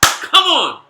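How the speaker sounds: high, loud burst; rousing shout. Real, not acted. Fan cheering his team mid-match, rousing shout. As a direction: yell it out to spur the team.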